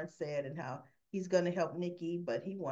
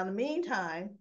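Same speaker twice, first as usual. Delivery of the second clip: normal talking voice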